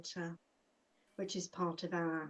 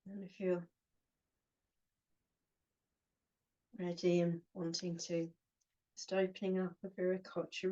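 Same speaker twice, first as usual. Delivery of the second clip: short phrases with long pauses between; unhurried meditation guide, giving each phrase room to settle; guided meditation. Acted. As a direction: guide them gently inward, phrase by phrase.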